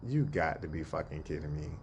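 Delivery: low, soft, flat disbelief; flat deadpan. Retold or imitated. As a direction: say it low and done with him. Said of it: "And I said it just like that".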